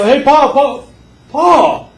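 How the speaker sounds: high, loud calling to Pa; calling down the hole. Retold or imitated. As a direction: call down into the pit. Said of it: "they go".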